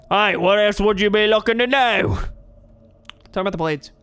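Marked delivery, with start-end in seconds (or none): 0.1-2.2 lightly voiced hearty old brawler; a friendly bearded fighter greeting a lad; burly trainer. McRae voice again.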